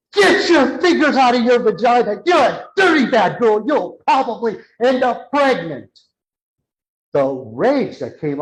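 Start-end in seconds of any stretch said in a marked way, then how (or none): 0.1-6.0 higher and louder scolding yell; mother's angry shout. Retold or imitated. A mother bursting in on her young daughter, shouting angrily. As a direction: scold the little girl, shouting.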